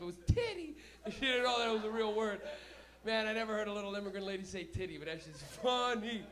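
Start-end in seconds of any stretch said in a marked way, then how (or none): 0.3-0.8 high, loud mock yelp; wound-up mother. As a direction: blurt it like a frantic mom. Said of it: "she was so wound up".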